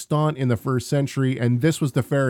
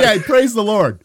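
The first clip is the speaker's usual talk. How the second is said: high, louder, laughing through praise the Lord; 9 semitones higher, 7 dB louder than usual. Retold or imitated. Laughing at the answered prayer, praising the Lord. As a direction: laugh it out in disbelief.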